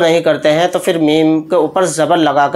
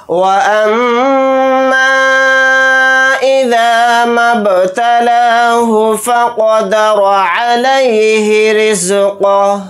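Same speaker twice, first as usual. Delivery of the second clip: slow melodic tajweed chant, pitched higher; Quran recitation; 4 semitones higher, much slower than usual. Acted. Teacher reciting the ayah aloud in a slow chant. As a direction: recite the verse slowly, with a sung lilt.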